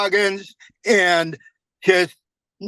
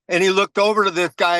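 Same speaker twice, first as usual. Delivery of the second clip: ordinary talk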